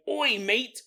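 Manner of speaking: a light put-on British teen accent, calling over; a hint of cockney lads beckoning. Teenagers in England calling Max over, British accent. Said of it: "they're like".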